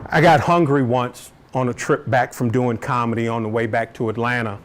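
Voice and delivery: usual speaking voice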